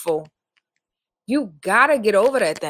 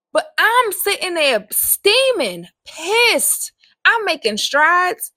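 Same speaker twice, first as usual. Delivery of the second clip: steaming anger; 7 semitones higher, 4 dB louder, slower than usual. Real, not acted. Recalls steaming at God.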